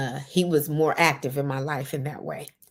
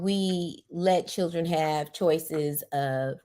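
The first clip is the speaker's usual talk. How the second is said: usual speaking voice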